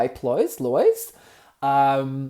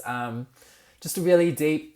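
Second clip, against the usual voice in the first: ordinary delivery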